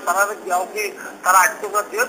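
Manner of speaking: ordinary talk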